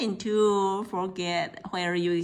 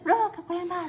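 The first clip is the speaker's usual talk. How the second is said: put-on children shouting; 10 semitones higher, 4 dB louder than usual. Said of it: "They shouted".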